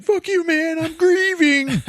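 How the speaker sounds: very high, loud wail, last word stretched; hammed-up wounded shriek; mock grieving outburst. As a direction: scream back through pretend tears. Bawling Steve-O yells back, offended.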